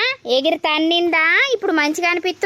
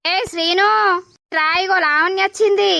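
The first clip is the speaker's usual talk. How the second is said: calling out; 5 semitones higher than usual. Acted. Calls Srinu over to see Lavanya.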